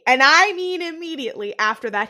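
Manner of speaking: normal talking voice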